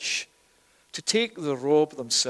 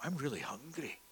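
very soft, private grumble; hungry moan to self; 3 semitones lower, 18 dB quieter than usual. Acted. Joseph alone in the pit smelling food, very quiet moan. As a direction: say it small and low-volume, to yourself. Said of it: "it is that he's going".

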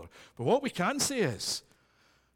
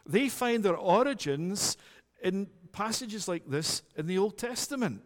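ordinary delivery

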